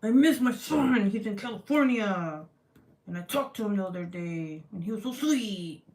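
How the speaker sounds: hammed-up growly craft narration; mock-angry talk. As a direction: growl sweet remarks with pretend fury. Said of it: "if I talk like that".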